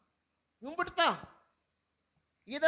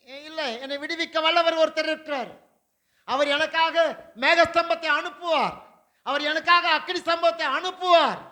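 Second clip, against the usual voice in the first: fervent preaching; 4 semitones higher, 5 dB louder than usual. Acted. Preaching at full force.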